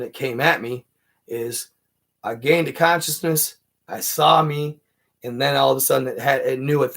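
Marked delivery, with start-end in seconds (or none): none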